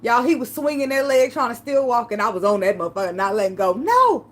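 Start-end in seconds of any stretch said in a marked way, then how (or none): 3.7-4.2 high, louder desperate no; clinging on, cry out no; high pleading no. Retold or imitated. To boyfriend leaving, a high pleading no.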